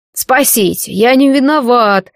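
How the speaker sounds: drawn-out prisoner's cry for help; understated wail of innocence; desperate plea. A narrator's reading. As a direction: call out slowly, pleading to be saved. Prisoner crying out for rescue.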